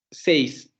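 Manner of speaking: ordinary delivery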